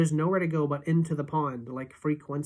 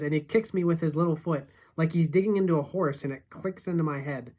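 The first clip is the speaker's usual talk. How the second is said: ordinary delivery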